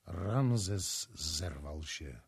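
ordinary delivery, nothing marked

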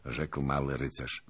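ordinary delivery, nothing marked

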